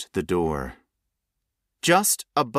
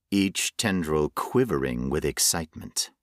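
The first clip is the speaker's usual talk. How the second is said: plain reading voice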